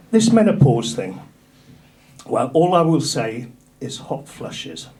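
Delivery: ordinary delivery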